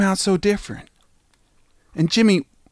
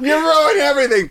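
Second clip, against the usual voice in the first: laughing outburst; 11 semitones higher than usual. Real, not acted. Cracking up at co-host who ruined the bit. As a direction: yell it while cracking up. Co-host botches the dramatic pause.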